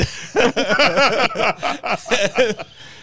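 loud, high cackling belly laugh. Retold or imitated. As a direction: let the laugh roll out high. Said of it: "he was just belly laughing".

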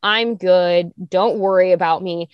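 ordinary delivery

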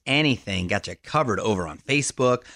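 low, smooth on-air read; radio host recap. Acted. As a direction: run through the show's highlights like a DJ.

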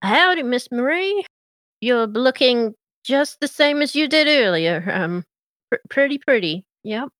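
a nervous small-town lawman fumbling a compliment; Deputy Mater voice. Acted. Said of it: "and he goes".

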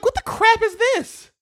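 high-pitched indignant outburst; antagonistic. Retold or imitated. Host retelling his reaction to a Netflix show, antagonistic outburst. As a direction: blurt it out, affronted and high. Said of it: "I said it very, you know, antagonistic".

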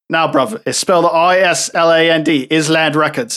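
hammed-up bad British accent; awful British accent. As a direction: insist in a bad British accent, ending in a shout on "Island Records". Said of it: "that accent was awful".

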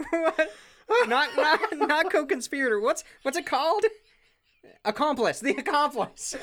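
high, flustered, searching for word. Real, not acted. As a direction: pitch up in flustered confusion. Flustered, can't find word.

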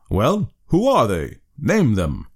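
cocked-hat gentleman interrogating the stranger sternly; austere demand. Acted. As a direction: question him like a suspicious magistrate. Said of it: "assumed a tenfold austerity of brow".

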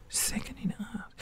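voiceless, very soft disbelief; stunned whisper. Retold or imitated. Student repeating their own award in disbelief, stunned whisper. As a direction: whisper it back, acting amazed.

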